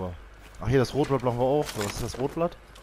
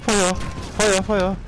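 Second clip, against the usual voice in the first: urgent shout; 8 semitones higher, 4 dB louder, much slower than usual. Real, not acted. Ruft Befehl im Kampf.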